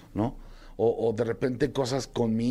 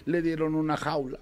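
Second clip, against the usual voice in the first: usual speaking voice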